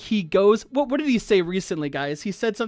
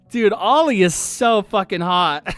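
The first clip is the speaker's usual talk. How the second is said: ordinary delivery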